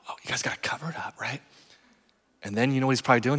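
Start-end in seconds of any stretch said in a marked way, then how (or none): 0.1-1.4 fast hushed whisper telling others to hide it; hurried whisper. Retold or imitated. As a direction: whisper quickly, conspiring. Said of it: "he's like".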